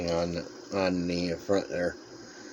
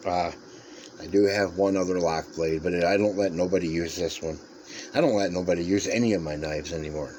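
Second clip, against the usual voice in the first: ordinary talk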